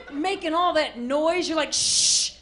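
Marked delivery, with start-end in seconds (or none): 1.7-2.3 loud, hissed shush; sharp shush. Acted. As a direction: shush the noisy kids. Shushing noisy kids while hungover.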